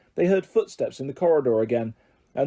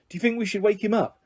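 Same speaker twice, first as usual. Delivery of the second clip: awkward, quick and higher; 7 semitones higher, faster than usual. A narrator's reading. Ron asks about the sleeping teacher, awkward and quick. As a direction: rush the question out uneasily. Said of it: "Ron asked awkwardly".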